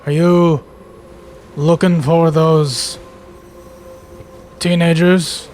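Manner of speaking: lightly voiced worker, words spaced out; worker calling out. Worker asking travellers about missing teenagers, calling out. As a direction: call slowly across to strangers. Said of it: "and they're like".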